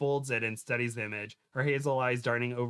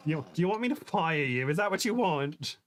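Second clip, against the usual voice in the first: a touch higher, put-on voice for the bossy actress; irritated starlet threat; 4 semitones higher than usual. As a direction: snap it at the driver like a spoiled star. Rebel threatens driver, first read.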